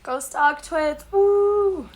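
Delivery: high, slow cheer with a whoop. Acted. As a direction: cheer it on like a fan. Cheering for sponsor.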